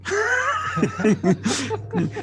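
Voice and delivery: high, loud burst of laughter. Real, not acted. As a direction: crack up loudly. Laughs at own story.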